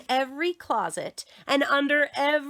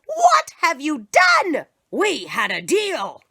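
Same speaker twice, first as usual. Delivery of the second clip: loud, high, slow furious yell; angry shout; 5 semitones higher, 9 dB louder than usual. Acted. Mother scolding her son over the mess, loud angry yell. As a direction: blast him for breaking the deal.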